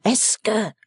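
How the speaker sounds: shy guest suddenly breaking in. Acted. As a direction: blurt it out and stop dead. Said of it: "in a raised voice".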